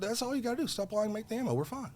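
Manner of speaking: put-on scolding the rep like his own kids; mock patient parent. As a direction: talk down like to a child.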